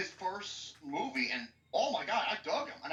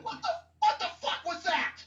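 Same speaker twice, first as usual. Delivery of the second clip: high, stumbling outburst of disbelief; exasperated outrage; 9 semitones higher, 3 dB louder than usual. Real, not acted. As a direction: burst out high, tripping over the start. Ranting about hated Joker.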